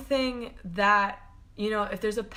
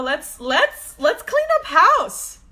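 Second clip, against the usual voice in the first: much higher, louder, slowed call; excited; 8 semitones higher, 11 dB louder, slower than usual. Real, not acted. Urging viewers to clean up house, ecstatic. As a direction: sing it out like a cheer.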